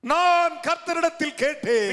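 high, loud and slow; drawn-out pulpit shout; full-force proclamation. Real, not acted. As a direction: cry the verse out to the crowd. Preacher proclaims the verse, then repeats quietly.

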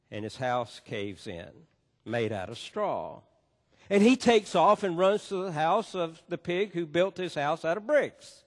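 ordinary talk, nothing marked

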